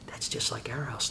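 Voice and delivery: soft unvoiced whisper; whispered aside. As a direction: whisper it to yourself in front of the TV. Dad quietly remarks during TV.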